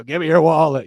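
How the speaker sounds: best American accent, a touch hammed-up. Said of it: "that's my best American accent".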